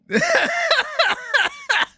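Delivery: squealing burst of laughter; helpless high-pitched cackle; high shrieking laugh. Real, not acted.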